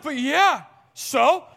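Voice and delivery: high, loud mock protest; guilt-tripping child. Son arguing back at his dad, high loud imitation. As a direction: push back like an upset kid, loudly. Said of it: "trying to guilt me".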